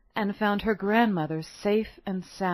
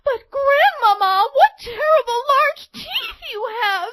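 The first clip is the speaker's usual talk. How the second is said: much higher, louder alarmed cry; frightened girl; 7 dB louder than usual. A narrator's reading. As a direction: cry out in fear.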